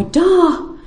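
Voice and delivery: put-on high baby warning cry; baby's cry of Da. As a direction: call it out like a toddler warning. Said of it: "they would cry".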